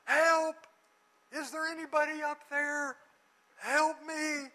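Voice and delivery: loud, high cry for help; yelling for help. Retold or imitated. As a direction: call up from the cliff edge as if you are dangling. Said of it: "he yells up".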